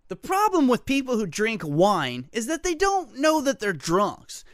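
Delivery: ordinary talk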